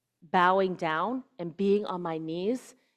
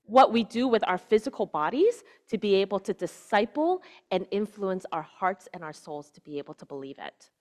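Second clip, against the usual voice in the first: ordinary talk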